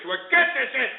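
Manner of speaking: high, furious yelling to the producer; angry shout. Real, not acted. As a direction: bark to get the caller cut off. Host yells to cut the caller.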